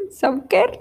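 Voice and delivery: Sauker asked with a high rising tune; checking Sauker aloud; unsure pronunciation check. Shown as a demonstration. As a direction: Sauker as a question, SAW-ker rising. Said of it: "¿Así se pronuncia?".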